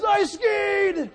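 high, loud joyful exclaim with a laugh; excited boy. Retold or imitated. Boy surfacing after skiing, excited laughing exclaim. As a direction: burst out proud and happy.

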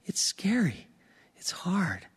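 breathy whisper, no voice at all. Real, not acted. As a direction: confide it in a hushed whisper. Admitting fear to the congregation.

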